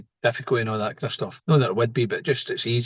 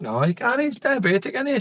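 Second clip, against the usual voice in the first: much higher mock-serious answer; mock deadpan reply; 12 semitones higher than usual. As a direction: play it as a mock-earnest joke, pitched up. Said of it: "I said".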